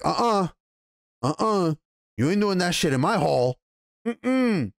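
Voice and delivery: imitating a lord saying absolutely not; retold firm refusal. Lord forbids fighting in his hall.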